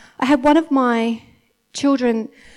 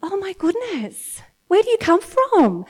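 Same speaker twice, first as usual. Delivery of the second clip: amazed mum; 5 semitones higher than usual. Retold or imitated. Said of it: "just get totally like".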